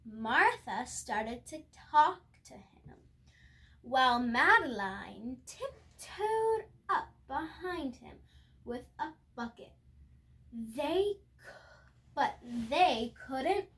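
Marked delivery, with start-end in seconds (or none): none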